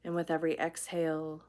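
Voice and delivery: soft, unhurried meditation voice with long pauses; guided meditation. Real, not acted. Teacher leading viewers into breathing and relaxation, guided meditation. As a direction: guide them gently through each breath.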